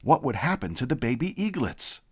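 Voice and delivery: usual speaking voice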